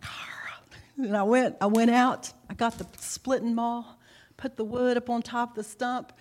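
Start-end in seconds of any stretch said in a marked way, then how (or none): 0.0-1.1 softer, breathy frustrated argh under the breath; bottled-up anger in a whisper; whispered growl. Retold or imitated. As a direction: growl it silently so he won't hear. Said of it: "And I'm like".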